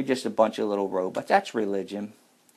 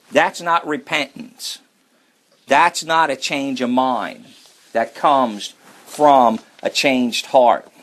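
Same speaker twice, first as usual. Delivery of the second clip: slow, raised preaching voice, each word pressed; preaching emphasis; 3 semitones higher, 5 dB louder, slower than usual. Acted. Preacher to congregation on repentance, slow and raised.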